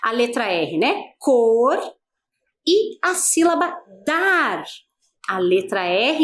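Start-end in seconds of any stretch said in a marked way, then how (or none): 1.2-1.8 COR held long, sounding the final R; demonstrated pronunciation. Shown as a demonstration. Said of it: "vamos fazer a pronúncia". 4.1-4.6 DAR drawn out to its final R; demonstrated pronunciation. Shown as a demonstration. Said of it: "faço ao pronunciar estas sílabas".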